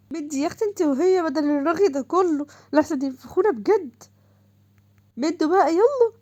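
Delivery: put-on girl rushing her chattering friends; urgent hurrying. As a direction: hurry them along.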